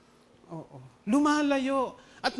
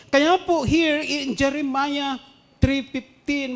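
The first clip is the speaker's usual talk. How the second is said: preaching emphasis; 5 semitones higher, 9 dB louder, slower than usual. Real, not acted. Preacher announces the scripture.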